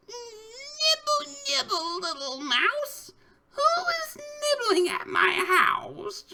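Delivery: put-on very high, squeaky old witch, drawn out slow. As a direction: squeak it out like a witch peeking from her house. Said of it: "a squeaky voice from somewhere says".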